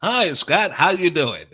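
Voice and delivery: imitating a radio man saying hello; radio announcer voice. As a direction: put on a big radio voice. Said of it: "he goes".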